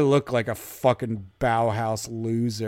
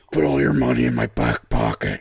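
very low gravelly-free growl of a hero; Batman voice. Pretend. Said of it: "have a Batman voice".

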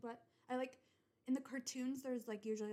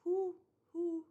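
high, loud hooting who; owl hoot; 7 semitones higher, 6 dB louder than usual. Real, not acted. Stumbling over who and sounding like an owl. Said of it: "I sound like an owl".

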